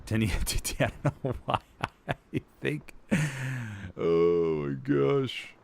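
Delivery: words broken up by giggles; laughing. Real, not acted. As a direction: talk helplessly through your own laughter. Cracks up at own mix-up.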